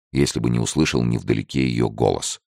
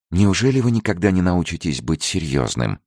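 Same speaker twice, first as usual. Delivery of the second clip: higher-pitched, mildly indignant reproach; angry scolding; 6 semitones higher than usual. A narrator's reading. Girl turning on Francis for not being serious, mildly angry. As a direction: scold him with a touch of temper. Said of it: "напустилась на него девушка".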